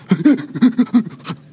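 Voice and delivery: high, stuttering mock sob; imitated losing-it cry. Dad showing the congregation his five-year-old's losing-it cry, imitated sobbing. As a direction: mimic a small child's broken, hiccuping crying. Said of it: "a cry of, "I'm losing it" kind of cry".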